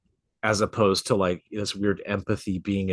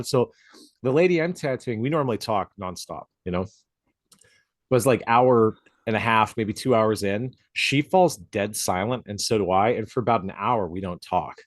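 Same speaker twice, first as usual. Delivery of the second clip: ordinary talk, nothing marked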